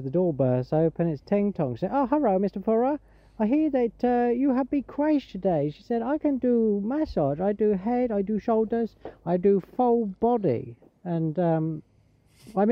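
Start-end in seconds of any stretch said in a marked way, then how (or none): 1.9-5.7 high-pitched imitated Thai lady accent. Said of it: "was a Thai lady". 6.0-10.7 put-on Thai accent. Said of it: "was a Thai lady".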